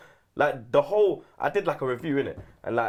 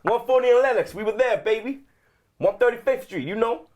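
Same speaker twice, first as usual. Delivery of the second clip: put-on hyped New York American accent, pitched up; 8 semitones higher than usual. A pundit back from New York riffs on Harlem streets in an American accent. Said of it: "that American accent".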